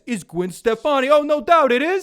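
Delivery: put-on high, loud New York accent; brash New York accent. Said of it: "like, a New York accent".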